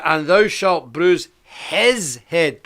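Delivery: slow, emphatic Bible verse; weighty quoted verse; solemn scripture reading. Acted. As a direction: lay out each word of the verse. Reads corrected verse.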